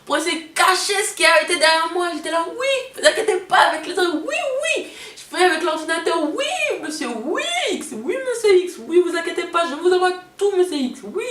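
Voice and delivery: high, loud phone-call voice; loud, high customer-service voice on the phone. Retold or imitated. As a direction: raise the voice to cover the noise, eager and polite. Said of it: "et je parlais plus fort".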